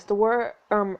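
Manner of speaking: ordinary delivery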